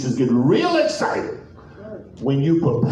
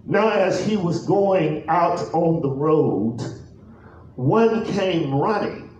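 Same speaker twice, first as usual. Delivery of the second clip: measured, deliberate pulpit reading; solemn, stretched-out Bible reading; scripture reading; 4 semitones higher than usual. Real, not acted. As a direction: read the verse out with long pauses between phrases. Reads scripture aloud.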